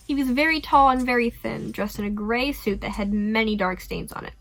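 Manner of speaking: ordinary delivery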